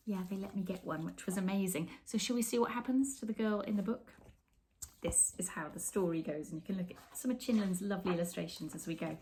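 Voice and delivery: ordinary delivery, nothing marked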